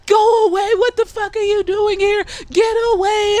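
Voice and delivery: high, loud mock-angry shout; mad. Montrose gets in his son's face, shouting mad. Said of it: "then he gets all mad".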